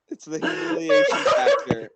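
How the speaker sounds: very high, loud, laughing; laughing squeal. Real, not acted. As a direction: squeal it while cracking up. Laughing at the prisoner.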